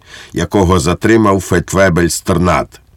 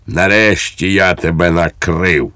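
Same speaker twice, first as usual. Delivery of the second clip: ordinary delivery, nothing marked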